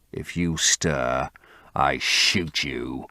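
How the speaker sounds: low, slow menacing threat; menacing gunman. A narrator's reading. Voices James Vane.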